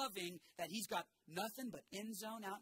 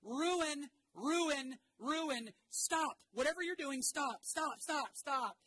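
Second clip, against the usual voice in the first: high, loud urgent warning shouts; preacher voicing Jesus crying out stop; screaming; 7 semitones higher, 6 dB louder than usual. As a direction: yell at them to stop. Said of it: "it feels like screaming".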